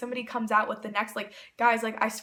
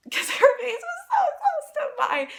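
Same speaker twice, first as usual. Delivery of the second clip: high-pitched laughing squeal, louder; 6 dB louder than usual. Real, not acted. Telling viewers how close Taylor's face was, laughing squeal.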